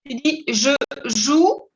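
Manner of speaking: Je joue said slowly, "joue" as zhoo; slow clear French model; French model phrase. Shown as a demonstration. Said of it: "se pronuncia hasta la O U".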